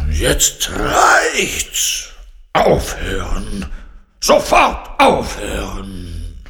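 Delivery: loud, drawn-out furious roaring; lion's angry roar. A narrator's reading. Lion orders Carla to stop playing, angry roar. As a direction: roar it like a lion at the end of its patience. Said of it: "brüllte der Löwe".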